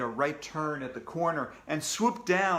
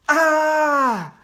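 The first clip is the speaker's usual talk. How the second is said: sudden high loud scream; frightened shriek; 11 semitones higher, 12 dB louder than usual. Acted. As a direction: shriek in terror at the ghost. Narrator gasps in fright.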